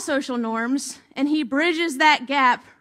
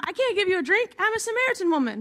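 higher and quick, a mock protest; protesting woman; 6 semitones higher, faster than usual. Samaritan woman refuses Jesus a drink, quick and high. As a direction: brush him off in a rush.

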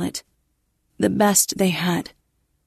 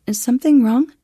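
higher, louder anxious question; faint fear; 5 semitones higher, 5 dB louder than usual. Acted. The blind module asking Katie, faintly afraid. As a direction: let worry creep into the question. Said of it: "a faint note of fear".

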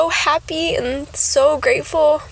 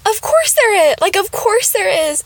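high amused exclamation; 8 semitones higher, 4 dB louder than usual. Retold or imitated. Retelling own amused reaction.

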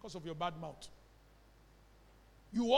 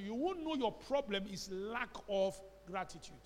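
ordinary talk, nothing marked

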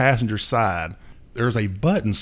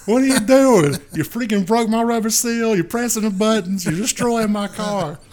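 high, louder mock rant; exasperated friend; 11 semitones higher, 3 dB louder than usual. Friend ranting about his broken car, high mock exasperation. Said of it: "He's like".

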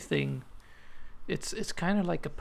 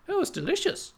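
mock delighted kids; 5 dB louder than usual. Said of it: "And we're like".

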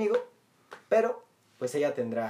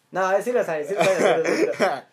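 loud, higher, through laughter; laughing admission; 4 semitones higher, 6 dB louder, slower than usual. Real, not acted. Host takes back a secret confession, laughing loudly. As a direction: burst out laughing as you admit it.

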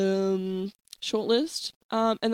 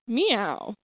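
Canadian meow. Acted. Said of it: "That was Canadian".

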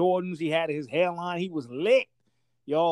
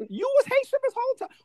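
high, stunned question; can't believe what he's hearing; shocked disbelief. Retold or imitated. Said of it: "I said, "Wait, hold up".

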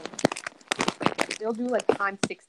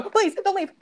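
much higher, louder pleading; high desperate plea; 11 semitones higher, 5 dB louder than usual. Real, not acted. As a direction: beg them not to go. Pleading as she falls.

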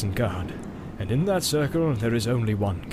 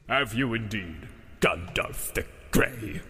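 slow, low, soft scornful Saruman; scoffing; 3 semitones lower, 6 dB quieter, slower than usual. A narrator's reading. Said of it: "he scoffed".

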